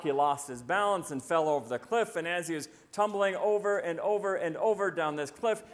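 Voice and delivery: ordinary delivery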